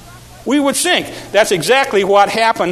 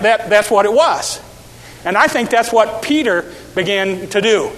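ordinary talk

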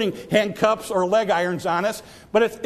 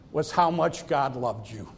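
tender hush; 8 semitones lower, 5 dB quieter than usual. Acted. Tender hush on God's love.

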